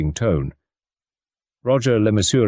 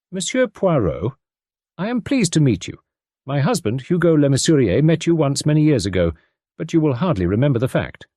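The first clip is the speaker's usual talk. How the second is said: lightly voiced American lady, higher; a hint of a transatlantic lilt, polite and keen; faint transatlantic accent; 6 semitones higher than usual. A narrator's reading. American mother greets Poirot, faint transatlantic accent. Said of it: "She spoke with a faint transatlantic accent".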